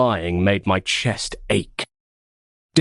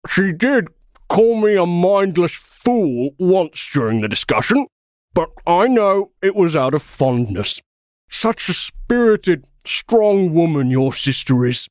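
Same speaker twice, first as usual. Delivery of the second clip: talking through a mouthful; mouth full; 8 semitones higher, 3 dB louder than usual. Acted. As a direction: chew while boasting. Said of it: "spoke with his mouth full".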